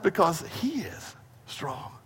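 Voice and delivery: soft drop on "he is strong"; hushed resolution. Real, not acted. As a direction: let "strong" fall away quietly after a pause. Preacher resolving the contrast softly.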